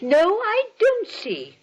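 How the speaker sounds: put-on a slow, stiff-necked old lady's no; indignant refusal. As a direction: dig in your heels, politely outraged. Aunt refuses Mortimer's plan.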